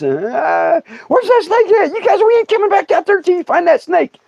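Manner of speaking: very high-pitched frantic auntie; mock panicked auntie. Auntie to cousins after falling over backwards, mock panicked scolding. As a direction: imitate a spooked aunt laying down the law.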